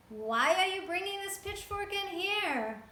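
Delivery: high, loud, slow indignant shout; shouting caretaker. A narrator's reading. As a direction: scold them for the mess. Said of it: "shouted at Beryl and Fievel".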